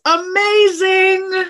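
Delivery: amazing, high and drawn out; excited emphasis. Real, not acted. Speaker sells facing fear to the interviewer, excited. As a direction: stretch it out high with delight.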